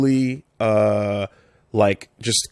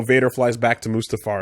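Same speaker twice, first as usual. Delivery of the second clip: ordinary talk, nothing marked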